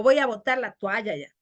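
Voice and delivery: "toalla" as tualla; hiatus made diphthong. Shown as a demonstration. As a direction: glide into "toalla" as twa-ya. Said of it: "Reducción de hiato a diptongo".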